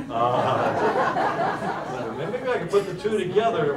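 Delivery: laughing through the words. Real, not acted. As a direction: laugh it off, amused at yourself. Laughing at his own slip.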